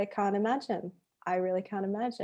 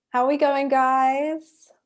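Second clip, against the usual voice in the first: higher, louder imitation of a teacher calling to the class; dance teacher; 6 semitones higher, 7 dB louder, slower than usual. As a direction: call out to a silent class as their teacher. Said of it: "I remember the teacher saying".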